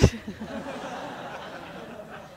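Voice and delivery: a soft, airy laugh at herself; breathy laughter fading away; real laugh. Real, not acted. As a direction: laugh it off, amused. Laughs at own story.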